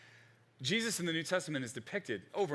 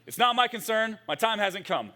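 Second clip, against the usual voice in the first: loud, higher, blunt "Woman"; blunt harsh reply; 6 semitones higher, 6 dB louder than usual. Retold or imitated. Jesus answers his mother bluntly, loud and curt. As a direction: snap it out like a curt son. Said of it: "he just says".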